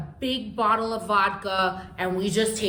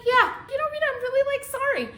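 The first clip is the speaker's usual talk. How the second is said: very high mock-sorry aunt; apologetic aunt; 14 semitones higher, faster than usual. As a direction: imitate a blithe apology, pitched way up. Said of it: "She was like".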